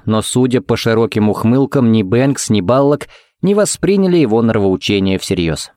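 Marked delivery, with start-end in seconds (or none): none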